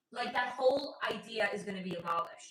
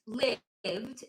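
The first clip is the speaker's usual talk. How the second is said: ordinary delivery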